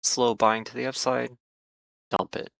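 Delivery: usual speaking voice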